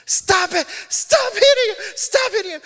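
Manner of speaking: imitated mom's shriek, very high and loud; hysterical yelling. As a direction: screech it like a frantic mother. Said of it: "she's yelling".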